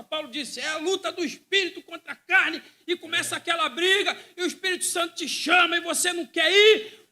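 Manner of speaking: high, loud, fervent sermon climax; full-force preaching. Acted. Preacher at full force telling the church the soul will cry out. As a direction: preach it with everything you have.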